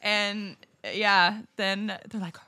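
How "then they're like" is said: ordinary delivery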